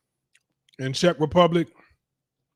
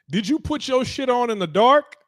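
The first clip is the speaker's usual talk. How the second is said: mock question through laughter; 7 semitones higher than usual. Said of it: "I'm like".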